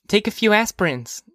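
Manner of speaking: put-on high child's voice; young girl. Daughter to mother about her headache, high girlish voice. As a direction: advise mother in a little girl's voice.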